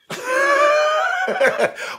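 long, high-pitched laugh. Real, not acted. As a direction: let a high laugh run on. Preacher laughs between phrases.

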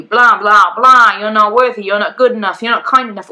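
loud, droning nag; nagging inner critic. Retold or imitated. The nasty inner voice nagging her, loudly. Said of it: "this voice that is always at you".

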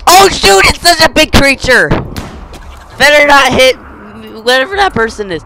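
loud, high alarmed yelling; alarmed shouting. Real, not acted. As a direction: shout in real alarm at the monster. Panic at big creature.

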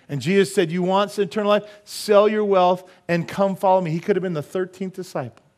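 high, loud, driving preaching; forceful preaching. Acted. Preacher telling of the rich young ruler, forceful preaching. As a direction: preach the story out, pitch held up.

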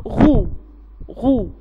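"غو" as ghayn plus damma, drawn slowly; letter sound demo. Shown as a demonstration. Teacher telling pupils how it is pronounced, sounding out "غو". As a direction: sound out "غو" as a lone syllable, twice. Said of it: "بنطقها".

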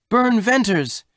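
very high exclamation of his name; astonished cry. A narrator's reading. Woman recognises the long-haired rider, astonished cry. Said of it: "Then Jane gave a cry".

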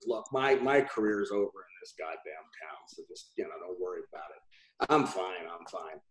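low, soft imitation of a client giving up; resigned client. Client brushing off his agent, retold low and resigned. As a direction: imitate him sinking into quiet resignation. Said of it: "He goes".

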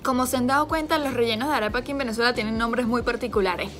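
talking while chewing food; speaking between bites of arepa; mouth full, chewing. Real, not acted. Seen 0: Holds arepa while talking, bites at 1.8, then appears to chew while speaking.

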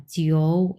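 জিও drawn out and low; slow, low-toned জিও; third tone. Shown as a demonstration. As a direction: dip the pitch slowly through জিও. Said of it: "এরকম উচ্চারণ করেছি".

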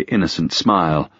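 ordinary delivery, nothing marked

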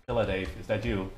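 ordinary delivery

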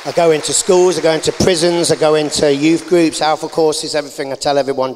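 a testimony preached loud and proud; fervent preaching. Real, not acted. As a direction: proclaim it like from the pulpit.

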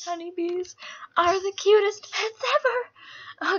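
cute squeal. Real, not acted. Gushing over pet honeybees.

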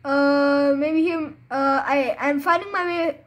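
usual speaking voice

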